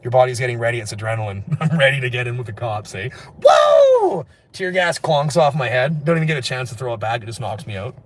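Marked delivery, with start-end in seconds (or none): 3.4-4.2 huge high, loud whoa; startled yell. Acted. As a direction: yelp as if struck on the head. Acting out being hit by tear gas.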